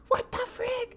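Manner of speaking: gasp, then tiny high squeal; mock freak-out. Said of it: "freaking out".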